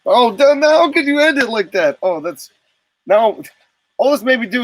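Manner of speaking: excited. Real, not acted. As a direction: blown away, voice jumping up. Second, bigger reaction to the promo.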